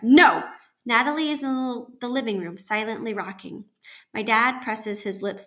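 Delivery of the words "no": loud outburst of refusal; anguished shout; crying out. Acted. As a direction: cry out the refusal at full volume. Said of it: "mom cries".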